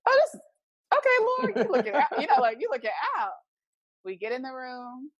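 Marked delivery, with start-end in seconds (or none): 0.1-3.3 high, loud burst of thanks; high-pitched mock gushing; acted-out delight. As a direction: exclaim it high and playful, giving thanks. Acting out her relieved reaction.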